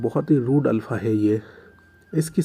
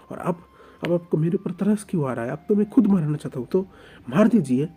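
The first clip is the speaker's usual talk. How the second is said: angry outburst; 3 semitones higher than usual. Acted. Oing to Lanjan, refusing food, in an angry outburst. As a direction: hurl every accusation back at him. Oing defies his captor.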